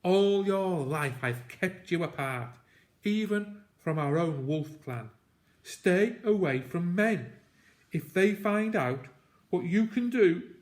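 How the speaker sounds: urgent warning. Acted. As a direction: press the warning on his son. Dying father's urgent warning.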